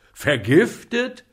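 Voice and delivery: apothecary's panic rising; horrified repeat. A narrator's reading.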